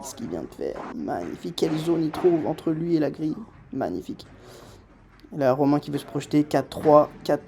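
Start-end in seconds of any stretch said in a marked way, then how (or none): 0.0-1.5 much lower and softer, awed; hushed admiration. Real, not acted. Admires the shot softly. 3.7-4.2 much lower and softer, awed; hushed admiration. Real, not acted. Admires the shot softly.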